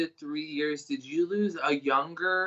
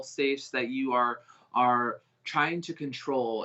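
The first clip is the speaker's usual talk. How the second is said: normal talking voice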